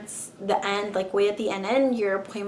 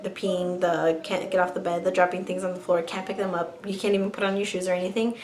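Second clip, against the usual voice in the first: usual speaking voice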